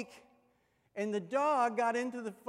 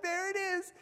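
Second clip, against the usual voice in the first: put-on eager kid; 10 semitones higher than usual. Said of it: "and I'm like".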